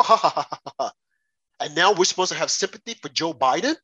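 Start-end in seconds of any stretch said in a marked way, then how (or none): 0.0-0.9 mocking laugh. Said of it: "everyone thought it was funny".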